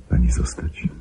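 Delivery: soft, breathy plea under the breath; pleading whisper. Acted. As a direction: whisper it as a last gentle appeal. Pleading with Mary to stay. (szeptem, błagalnie)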